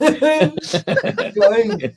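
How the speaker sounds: cracking up at his own joke; laughing. Real, not acted. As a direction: laugh your way through it.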